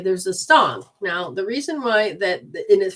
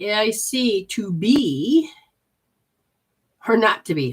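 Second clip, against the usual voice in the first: slow hammed-up recital of to be or not to be; lightly theatrical Shakespeare line; mock dramatic Hamlet; 2 semitones higher, 3 dB louder than usual. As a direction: declaim it like a stage actor, slowly. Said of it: "made me say it".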